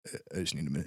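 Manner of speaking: low, soft stunned murmur; low dazed mumble. Retold or imitated. Voicing a dazed viewer.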